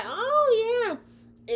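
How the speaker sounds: high, loud squeal of a townsperson; mock townsfolk. Townsfolk squealing at the news, high and loud. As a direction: squeal it like a gossiping villager. Townsfolk reacting in retold story.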